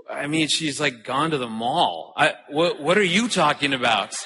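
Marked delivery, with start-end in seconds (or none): 2.1-4.1 louder, half-laughing disbelief; a laughing, baffled "what?"; bewildered. Retold or imitated. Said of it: "I remember thinking".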